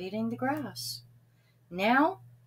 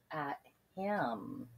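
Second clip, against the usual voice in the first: ordinary delivery, nothing marked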